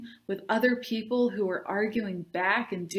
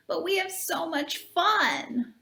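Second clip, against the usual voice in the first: higher, slow put-on boy voice; Doug's voice; 8 semitones higher than usual. A boy pitching the club to Natalie, slow and higher. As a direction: read Doug slower and higher than the narration. Doug voiced in read story.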